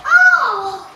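loud high yelp of cold shock; shocked gasp. Real, not acted. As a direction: cry out as the icy water hits. Plunging into ice water.

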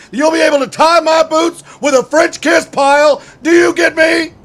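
barking drill instructor tearing into a recruit; drill sergeant. Acted. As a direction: berate him like a Marine drill sergeant.

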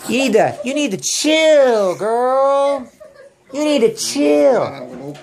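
high, slow, stretched-out teasing; playful exclaiming. Pretend. Parent teases girl with the practice word, playfully exclaiming. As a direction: draw out the last word long and high.

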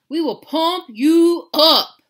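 high, loud, slow pumped-up promise; big dog voice. Acted. Dog promising the kitten, loud and slow. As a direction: boom it out slowly. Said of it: "woofed Don Diego".

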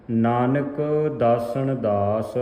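slow recitation resting on last ਦਾਸ; sustained phrase closing on long ਦਾਸ; pause after ਦਾਸ. Shown as a demonstration. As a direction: stretch the final ਦਾਸ, then pause. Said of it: "ਦਾਸ ਤੇ ਵਿਸਰਾਮ ਹੈ".

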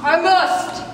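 loud theatrical outburst; acted shout. Character echoes the writer's line, dramatic shout. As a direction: shout it like a stage hero. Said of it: "Claude shouts".

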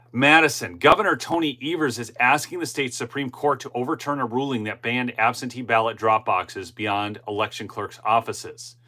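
ordinary delivery

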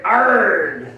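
loud, laughing pirate arrgh; pirate growl. Acted. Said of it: "this is where we learned to talk like a pirate".

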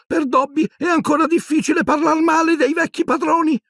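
very high, breathless elf thanks; panting elf. A narrator's reading. Dobby panting thanks to Harry after struggling, very high.

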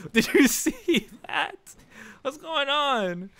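high, drawn-out disbelief; slow high-pitched incredulous cry; astonished. Real, not acted. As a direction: stretch it out high in amazement. Astonished reaction during gameplay.

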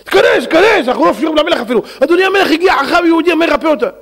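high-pitched, eager announcing; mock urgency. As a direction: usher him in with high comic urgency. Servants urgently announce the healer.

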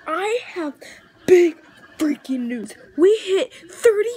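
big breaking news, climbing to a high breathy squeal; real giddy announcement, punched out in bursts; fanboy excitement. Real, not acted. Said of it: "I'm gonna go fanboy right here".

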